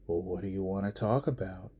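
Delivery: quiet smug jab at Cody; mocking. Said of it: "you know he's mocking Cody".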